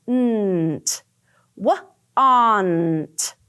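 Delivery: segmented blending of W and aunt; sounding out. Shown as a demonstration. Teacher blending sounds for first graders. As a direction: break the word into its parts. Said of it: "we're gonna say".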